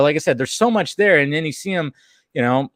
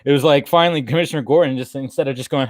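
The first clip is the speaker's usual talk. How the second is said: ordinary talk, nothing marked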